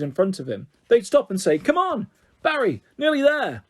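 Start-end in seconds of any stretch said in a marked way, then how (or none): 1.6-3.6 high, bright coaxing call; the runners call back encouragement to Barry; cheering on. Acted. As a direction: egg him on like a coach. Sporty parents cheer Barry on.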